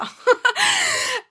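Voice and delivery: high cackling laugh; witch cackle. Acted.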